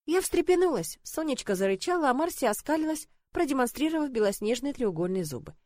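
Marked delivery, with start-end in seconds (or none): none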